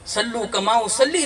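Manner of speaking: mock the wrong wording with a laugh; wrong version laughed off. Shown as a demonstration. Preacher to congregation, laughing at the wrong wording. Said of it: "ये नहीं कहा".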